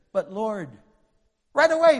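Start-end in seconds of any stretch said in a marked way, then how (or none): 1.5-2.0 loud. Real, not acted. Burst of emphasis.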